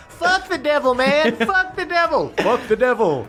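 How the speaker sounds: high, rowdy crowd cheer; cheering shout. Acted.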